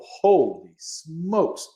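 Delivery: slow, drawn-out holy smokes; hammed-up amazement; amazed reaction. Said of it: "making me go".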